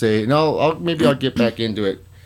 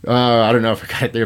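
normal talking voice

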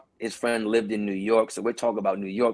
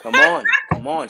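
much higher, louder "come on"; joining the church-like praise, excited shout; hyped; 7 dB louder than usual. Real, not acted. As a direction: cheer it on. Hyping the praise moment.

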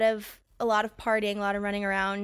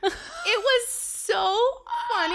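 laughing; 11 semitones higher, 4 dB louder, much slower than usual. Real, not acted. Laughing about story.

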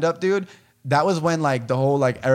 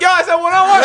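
much higher, loud mock yell; retold shout; 8 dB louder, faster than usual. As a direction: yell it indignantly at the bouncers. Retold yelling as bouncers rush.

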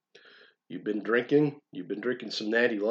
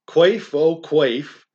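fervent plea; 5 semitones higher, 8 dB louder, much slower than usual. Acted. Pleading to drink nepenthe.

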